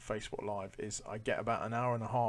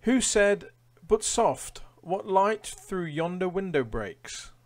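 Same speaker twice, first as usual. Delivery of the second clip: much higher and louder, theatrical reading; dramatic quiz read; 9 semitones higher, 11 dB louder, much slower than usual. Acted. Quizmaster reading a Shakespeare quote to players, theatrical. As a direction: give the Shakespeare line a grand flourish.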